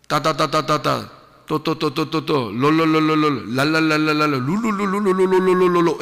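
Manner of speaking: rapid nonsense syllables; preacher mimicking tongue-speaking to ridicule it; mock tongues babble. Shown as a demonstration. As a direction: rattle off fake glossolalia mockingly. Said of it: "일어나자마자".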